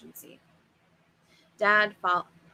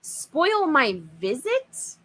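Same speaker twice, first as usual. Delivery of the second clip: slow, louder, higher outraged echo; shrieking disbelief; 6 semitones higher, 4 dB louder, much slower than usual. Acted. Father outraged at the monster's words, slow shrieking echo. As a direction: spit the words back in disbelief. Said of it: "Dad shrieked".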